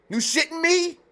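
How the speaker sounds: high-pitched disbelief; incredulous. Real, not acted. Streamer reacting to teachers' outfits, incredulous. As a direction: squeal it upward in disbelief.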